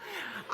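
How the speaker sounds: quick high laugh at own joke; short real giggle. Real, not acted. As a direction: break up laughing.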